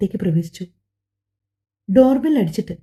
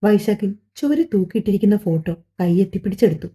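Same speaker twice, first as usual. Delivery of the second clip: ordinary delivery, nothing marked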